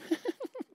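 high, soft imitated snickering; stifled snicker. Onlooker watching someone get in trouble, stifled snicker. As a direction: snicker from the back of the room. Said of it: "you're in the back and you're like".

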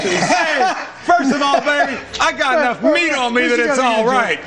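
laughing. Real, not acted. Anchor laughing while joking.

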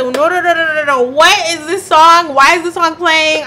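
high, loud, rapid no no no; frantic loud protest; loud alarmed protest. Retold or imitated. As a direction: shout your objection at the heavens. Panicked protest to God.

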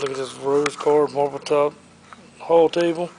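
ordinary talk

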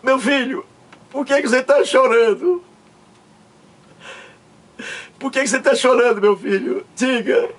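high, hammed-up weeping question; tearful father. Father asks his son why he is crying, mock sobbing. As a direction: quote the father through exaggerated sobs.

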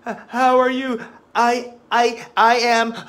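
high, loud, stammering panic; hammed-up flustered schoolboy; panicking boy. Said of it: "He's panicking".

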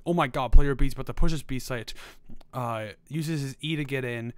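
loud, excited play-by-play; hyped caster. Shown as a demonstration. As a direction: call it like the crowd's roaring. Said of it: "It's not gonna be saying".

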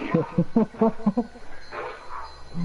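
high unvoiced wheeze of a laugh; airy high giggle; breathless laugh. Real, not acted. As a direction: crack up silently. Laughing at joke.

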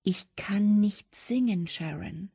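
slow, frightened whisper; terrified. A narrator's reading. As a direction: force it out trembling, barely voiced. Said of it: "zitterte und stieß völlig verängstigt hervor". (verängstigtes, zittriges Flüstern)

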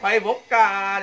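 ordinary delivery

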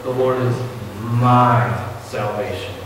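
my salvation, my drawn out slowly; pause, then press my; stress on my. Shown as a demonstration. Preacher stressing the missing word my. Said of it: "David says".

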